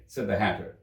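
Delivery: ordinary delivery, nothing marked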